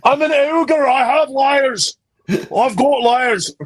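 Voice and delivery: high, slow bad Scottish ogre voice; Scottish accent. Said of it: "that was a terrible Scottish accent".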